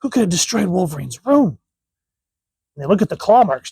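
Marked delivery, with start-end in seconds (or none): 0.0-1.6 lightly voiced hero. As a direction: read the panel like a shocked teammate. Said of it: "And he goes".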